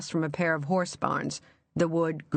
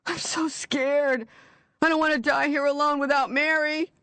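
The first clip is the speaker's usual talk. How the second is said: terrified crying; 10 semitones higher, 3 dB louder than usual. Acted. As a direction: sob out the fear of dying alone. Buried man pleads in terror.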